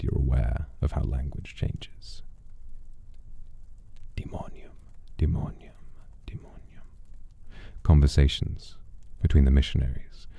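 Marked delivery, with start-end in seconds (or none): 4.2-6.9 fading whispered repetitions; whispered echoes. Shown as a demonstration. Narrator whispers the name as it fades away. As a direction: whisper it, each time softer. Name shifting over time.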